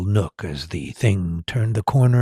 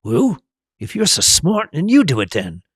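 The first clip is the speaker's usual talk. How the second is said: snarling retort; 6 semitones higher than usual. A narrator's reading. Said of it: "snarled Ron".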